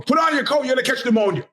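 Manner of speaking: shouting high like a worried mom; nagging mom. Retold or imitated. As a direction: yell it like a mother calling after her kid. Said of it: "My mom".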